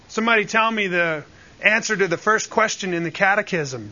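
usual speaking voice

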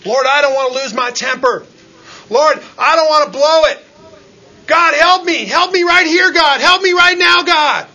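loud, high, desperate plea to God; pleading prayer cry. Retold or imitated. Preacher reenacting his crying out to God, loud and pleading. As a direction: beg God for help at full voice. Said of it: "And I say".

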